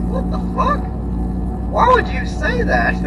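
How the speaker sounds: high, stunned outrage; retelling a coworker's insult, shocked and high; shocked disbelief. Retold or imitated. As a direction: squeak out your disbelief at the insult.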